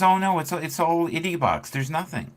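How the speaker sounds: high-voiced mock of a blustering boss; lightly voiced scoffing boss; dismissive boss. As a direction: brush it off like a clueless manager. Boss dismissing in retold story.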